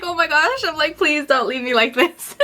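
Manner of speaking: high, mildly panicked begging; mock pleading. Client begging staff to stay, mock pleading. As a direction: beg in exaggerated dismay. Retold plea not to be left.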